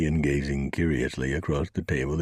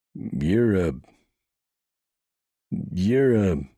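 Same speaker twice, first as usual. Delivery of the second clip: higher, louder halting start; stumbling over the words, can't get it out; stammering deputy; 6 semitones higher, 4 dB louder, much slower than usual. Acted. Deputy stammering nervously to the marshal.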